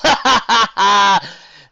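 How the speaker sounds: loud, high hysterical laugh; hysterical relieved laugh. Acted. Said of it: "an almost hysterical laugh of relief".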